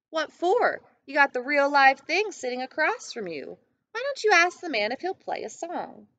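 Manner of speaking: a lively grandma teasing her grandson; Nana's voice. A narrator's reading. Nana voiced in story.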